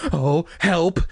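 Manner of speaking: high-pitched acted plea; strained cry for help. A narrator's reading. Boy shaking at the booth, crying for help. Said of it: "he managed to cry".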